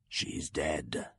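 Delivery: bassa e piano, lontana; un pensiero sommesso nel sonno; voce del lupo. Acted. Wolf's thought voice.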